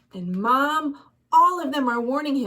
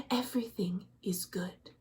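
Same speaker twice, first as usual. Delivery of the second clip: soft breathy whisper; whispered greeting; 2 semitones lower, 10 dB quieter than usual. Acted. Villager whispering to a neighbor in fear. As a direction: hush it so the ghoul can't hear. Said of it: "They would whisper to each other".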